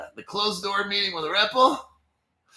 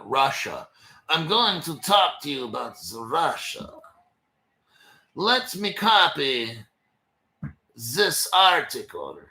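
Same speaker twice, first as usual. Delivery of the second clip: put-on shaky German-Russian accent; 5 semitones lower than usual. Host jokily introduces an article about Russia in a muddled German-Russian accent. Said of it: "I think I'm getting German accent going on".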